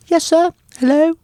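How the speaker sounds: very high English accent. Shown as a demonstration. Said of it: "that are high".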